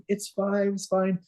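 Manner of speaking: high, light reassurance; comforting. Retold or imitated. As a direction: soothe the kids kindly. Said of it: "I was like".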